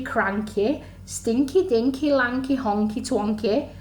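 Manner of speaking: ordinary delivery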